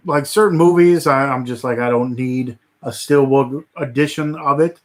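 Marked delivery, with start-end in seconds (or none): none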